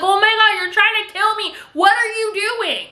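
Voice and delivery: put-on high-pitched freak-out of a bratty child; yelling. Little girl accusing the nanny, high mock yelling. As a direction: imitate a girl yelling in outrage. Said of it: "Cecilia starts yelling".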